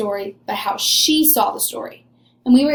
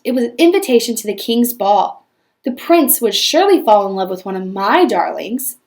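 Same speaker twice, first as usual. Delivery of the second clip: normal talking voice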